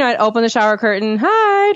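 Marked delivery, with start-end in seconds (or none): 1.2-1.7 very high, singsong mommy greeting; cheery high-pitched baby talk; cheering up baby. Retold or imitated. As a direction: imitate a peekaboo hello to a baby. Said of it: "try to, like, cheer him up".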